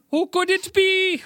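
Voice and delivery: very high, botched Jamaican singsong; bad Jamaican accent. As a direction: badly fake a high-pitched Jamaican accent. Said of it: "that's not the right accent".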